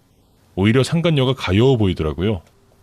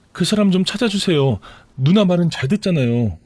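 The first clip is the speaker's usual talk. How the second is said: higher, tearful-sounding plea from the mistress; pleading mistress; 6 semitones higher than usual. Acted. Mistress begging her lover's sister for help, pleading.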